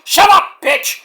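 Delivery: high, loud snapping shout; angry shout. Retold or imitated. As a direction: yell to shut a partner up. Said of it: "I'm like".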